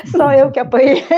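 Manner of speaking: laughing emphasis. Retold or imitated. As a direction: stress "Só" hard and laugh it out. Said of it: "Eu falei".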